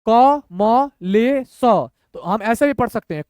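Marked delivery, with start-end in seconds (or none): 0.1-1.6 very slow, every letter separate; syllable by syllable. Shown as a demonstration. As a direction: sound it out letter by letter. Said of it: "तोड़ तोड़ के पढ़िए".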